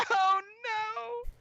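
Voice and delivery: very high, hammed-up cry; dismayed wail. Young soldier spills the rounds, high dismayed wail. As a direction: squeal it in mock despair. Soldier drops box of ammo.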